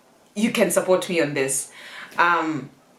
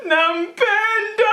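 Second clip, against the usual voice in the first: high, long drawn-out bawl; hammed-up sobbing declaration of love; mock crying wail; 4 dB louder, much slower than usual. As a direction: wail it out melodramatically, every vowel stretched. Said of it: "that's how I was crying".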